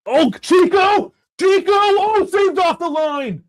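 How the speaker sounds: high, loud commentary yell on a near goal; high, drawn-out shout of disbelief as the shot is saved; excited yell. Real, not acted. Big chance in live match.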